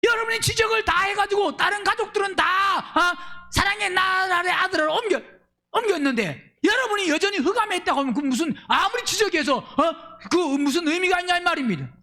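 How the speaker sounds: shouting, pleading sermon climax; impassioned preaching. Acted. Pastor rebuking the congregation, shouting and pleading.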